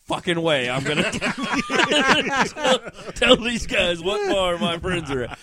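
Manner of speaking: slow, laughing through the words. Retold or imitated. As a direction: chuckle while saying it. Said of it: "And I was like".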